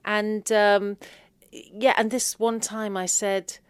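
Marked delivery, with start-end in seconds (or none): none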